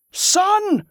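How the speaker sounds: high full-strength shout. A narrator's reading. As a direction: shout it to the crowd. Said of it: "he shouted to the crowd with all his strength".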